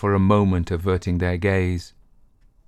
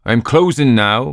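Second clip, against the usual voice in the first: loud, higher barman boom; booming male voice; 4 semitones higher, 7 dB louder than usual. Acted. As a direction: boom it from above. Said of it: "boomed a voice above him".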